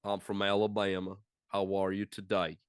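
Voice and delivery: put-on joking Deep South accent; mock southern accent. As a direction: put on a low Alabama accent. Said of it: "Kinda southern a little bit".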